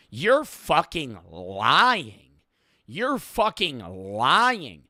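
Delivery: "lying" stretched long with a swooping rise and fall; slow, incredulous stretch on "lying"; drawn-out LYING. Real, not acted. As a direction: drag out "lying" very slowly and emphatically. Emphatic accusation, word drawn out.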